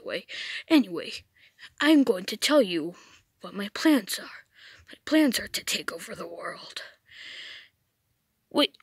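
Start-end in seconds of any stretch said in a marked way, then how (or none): 2.0-6.8 low, softer whispered villain plan; sinister whisper. Acted. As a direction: hush it low like a scheming villain.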